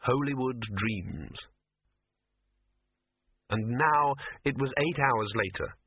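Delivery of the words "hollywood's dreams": ordinary delivery, nothing marked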